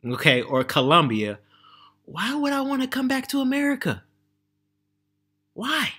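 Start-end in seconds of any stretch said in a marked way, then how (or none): 2.0-5.9 incredulous question. Real, not acted. Vlogger questioning any return to America, incredulous.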